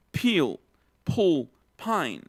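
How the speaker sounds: "Peel, pull, pine" each p followed by a puff of air; aspirated p. Shown as a demonstration. Teacher repeats the aspirated p words for the class. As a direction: breathe out hard after every p. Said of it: "Otra vez".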